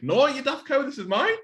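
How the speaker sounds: high, loud mock indignation at his girlfriend; mock outrage. Telling his girlfriend the brisket is his, high mock scolding.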